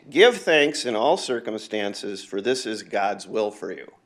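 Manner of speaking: loud, slow preaching of the verse; scripture proclamation. Acted. Reads scripture verse aloud.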